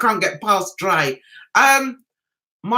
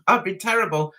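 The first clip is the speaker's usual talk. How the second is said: ordinary talk, nothing marked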